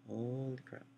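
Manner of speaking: very soft, under the breath; barely audible reaction; stunned aside. Acted. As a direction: mutter it in hushed disbelief.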